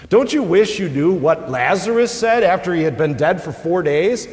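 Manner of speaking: lifting the congregation toward comfort, preaching hard; fervent preaching. Acted. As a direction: preach it out with rising force. Preaching at full force.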